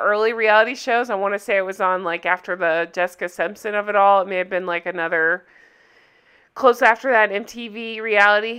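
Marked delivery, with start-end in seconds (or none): none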